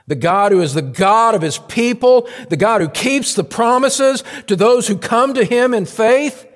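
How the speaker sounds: high, loud pulpit crescendo; fervent, climbing sermon delivery; impassioned preaching. Real, not acted. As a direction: lift it into a ringing declaration. Preaches at full force.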